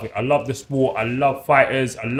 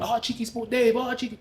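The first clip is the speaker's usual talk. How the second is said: higher-pitched mock call from the stands; fans calling out; 11 semitones higher, 5 dB quieter than usual. Fans at the stadium greeting him, a lightly voiced high call. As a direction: call his nickname like a fan spotting him. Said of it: "couldn't go to a stadium without being known".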